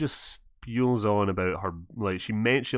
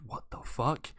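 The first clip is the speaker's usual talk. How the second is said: whispered, baffled reaction; hushed mimicked disbelief; mouthed disbelief; 9 dB quieter than usual. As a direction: whisper it in mock bewilderment. Mimes her bewildered look.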